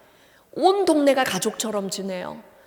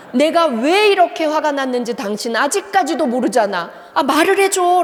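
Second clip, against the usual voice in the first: louder, rising to a higher pleading cry; a wife losing patience with her husband; mock-angry "just tell me"; exasperated scolding; 4 semitones higher, 5 dB louder than usual. Wife berating husband in a fight.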